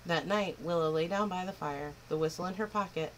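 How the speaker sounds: ordinary delivery, nothing marked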